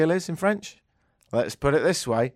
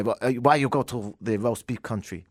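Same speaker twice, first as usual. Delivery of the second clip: mock French accent; 4 semitones lower, 5 dB quieter than usual. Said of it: "they say".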